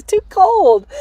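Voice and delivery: high, loud whiny dog voice; dog's voice. Retold or imitated. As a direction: whine it like the dog. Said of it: "He says".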